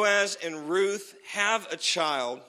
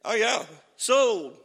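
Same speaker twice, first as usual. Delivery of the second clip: slow, loud, high mock seller's call; hammed-up merchant sealing the deal; cheerful seller; 7 semitones higher, 9 dB louder, slower than usual. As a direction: sing out the sale like a pleased landowner. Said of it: "They'd say".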